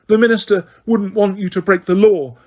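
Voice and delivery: high, urgent pleading; desperate plea. A narrator's reading. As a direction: try to talk her down. Hermione pleading.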